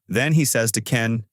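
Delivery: ordinary delivery, nothing marked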